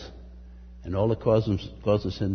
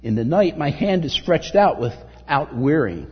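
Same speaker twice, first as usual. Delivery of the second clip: slow, raised verse reading; preaching scripture; 3 semitones higher, 4 dB louder than usual. Acted. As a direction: stretch the verse out slowly. Reads psalm with force.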